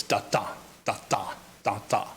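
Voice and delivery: low, soft "da DA" pulses, weak then strong; iambic stress. Shown as a demonstration. Lecturer showing what an iamb is, weak-strong "da DA". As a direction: beat out "da DA" in separate pairs, second one stronger. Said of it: "a weak accent followed by a strong one".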